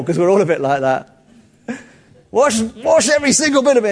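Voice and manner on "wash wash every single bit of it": over the top. Retold or imitated. Peter voiced over the top.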